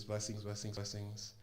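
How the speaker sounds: very soft, repeated benediction; soft blessing. Retold or imitated. Swamiji's first words to two brothers, soft blessing. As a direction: imitate a guru blessing under the breath.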